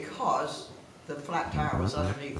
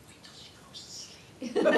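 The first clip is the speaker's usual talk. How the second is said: whispered conspiratorial plan; 9 semitones higher, faster than usual. Retold or imitated. Chaperone whispers to the kids about sneaking out. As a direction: hush it like a secret to the kids. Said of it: "I couldn't hear you".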